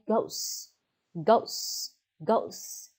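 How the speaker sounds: whispered goats with a hissed final s; goats, goats, goats ending on a clear hissing s; final s sound. Shown as a demonstration. Teacher drilling the plural to the class, hissing the final s. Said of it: "You have to say goats".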